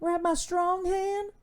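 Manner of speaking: high, slow, eerie movie villain voice; creepy movie voice. Retold or imitated. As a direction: drawl it out high and slow like a creepy horror character. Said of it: "Scary movie".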